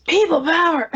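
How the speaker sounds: high, loud mock catchphrase; People power catchphrase. Co-host mimicking a wrestling personality's catchphrase, high mock shout. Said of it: "And he was on it".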